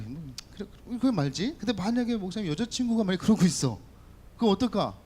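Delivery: ordinary delivery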